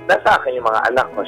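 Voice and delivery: ordinary delivery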